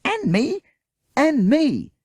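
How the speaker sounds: put-on high, loud repeated child's yell; calling out. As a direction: shout it over the others.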